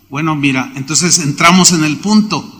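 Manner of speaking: normal talking voice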